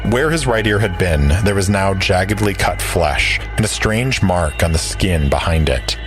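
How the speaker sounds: normal talking voice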